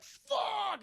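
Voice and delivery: high, yelped curse; pained cry. Retold or imitated. Monster hit by fire breath, yelping. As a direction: yelp as the fire hits. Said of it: "she's like".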